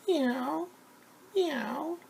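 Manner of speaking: very high rising meow; cat meow. Acted. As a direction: mew like a little cat.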